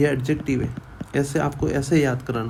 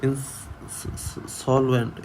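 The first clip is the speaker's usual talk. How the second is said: Insolvent stretched out very slowly, each syllable clear; slow model pronunciation; 4 dB quieter, much slower than usual. Shown as a demonstration. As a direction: drawl out insolvent so students hear every sound. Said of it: "ई की जगह ए बोलते हैं".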